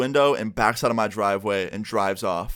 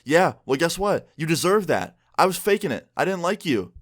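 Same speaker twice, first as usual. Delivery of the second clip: higher-pitched imitation of a spiteful ex; mock spiteful comeback; 7 semitones higher than usual. Ex hitting back at him, mock spiteful comeback. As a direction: act out her scornful retort, pitched up. Ex hits back in acted quarrel.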